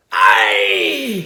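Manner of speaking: sudden loud cry of pain; scream. A narrator's reading. Axe man bitten by the fox, screaming. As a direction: yelp it out as the hand is bitten. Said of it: "he screamed".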